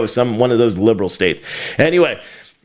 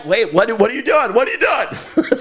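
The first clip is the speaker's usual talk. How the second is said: much higher, mock-bewildered squeak; mock baffled; 11 semitones higher than usual. Canvasser on the doorstep, baffled and high-pitched. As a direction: play the door-knocker confused at being told to leave. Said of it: "knocking on a door and saying".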